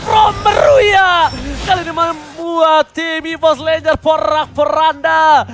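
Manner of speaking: rising hype, yelling the comeback; excited shout. Real, not acted. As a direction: scream it like a big play. Caster erupts at comeback.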